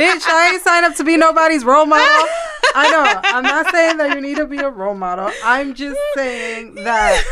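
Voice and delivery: loud, high, laughing outburst; laughing rant. Real, not acted. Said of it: "your New Yorkness".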